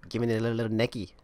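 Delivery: quick mock Australian accent. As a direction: try out a line in an Australian accent. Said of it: "an Australian kind of thing".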